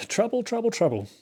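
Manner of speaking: sing-song "trouble" pitched high then dropping back; playful singsong about a bad board position; mock alarm. Real, not acted. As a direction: tease out the worry like a little tune.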